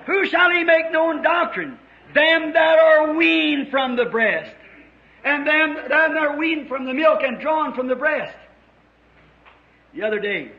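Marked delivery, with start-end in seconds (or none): 0.1-8.3 raised, ringing scripture proclamation; preaching scripture. Real, not acted. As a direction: declaim the verse to the congregation, higher pitched.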